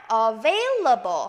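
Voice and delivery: slow "Available" with a buzzing V; voiced V. Shown as a demonstration. Teacher modelling the V sound, slow and voiced. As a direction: say "Available" drawing out the VAIL. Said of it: "성대를 써주셔야 돼요".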